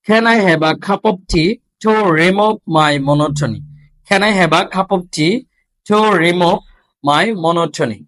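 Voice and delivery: very slow, deliberate reading with breathy stops; slow drill. Shown as a demonstration. Teacher slowly modelling a question for students. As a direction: read it slowly, puffing each stop. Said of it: "তারপর".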